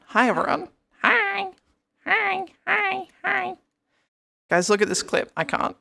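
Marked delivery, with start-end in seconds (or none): 0.1-3.5 cutesy high greetings. Pretend. Greeting chat in a squeaky voice.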